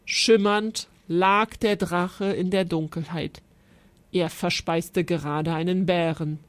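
ordinary delivery

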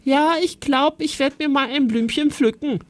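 high, put-on innocent voice; hammed-up sing-out, pitched high; playing along. A narrator's reading. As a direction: pretend casually, for a hider's ears. Playing along loudly for hidden sister.

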